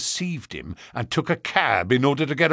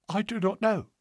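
higher, soft frightened answer; scared and hushed, losing colour; 9 semitones higher, 6 dB quieter than usual. Acted.

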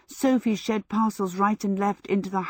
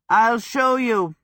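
louder, slow call flung back while running; called out, drawn out; 4 semitones higher, 5 dB louder, slower than usual. A narrator's reading. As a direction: shout it over your shoulder heading upstairs. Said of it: "he called".

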